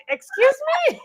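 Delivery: high, incredulous excuse me; mock shocked. Reacting to the cast's real ages, high and incredulous. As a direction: act offended disbelief. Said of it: "I was like".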